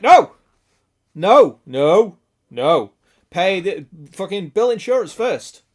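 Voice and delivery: loud, emphatic mock protest; mock refusal. Streamer acting out a viewer refusing to pay, loudly defiant. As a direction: belt out the first no, then drop in pitch.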